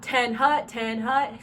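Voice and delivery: TEN and HUT stretched out long and loud; long, drawn out. Shown as a demonstration. Coach shows drum majors the wrong call, long and drawn out. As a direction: drag out each word of "Ten hut". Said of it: "See how long and drawn out".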